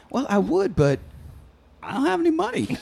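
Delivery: high put-on old lady, breaking into laughter; grandma voice. Grandma explaining unpaid taxes, high-voiced. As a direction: squeak it as an imitated grandma.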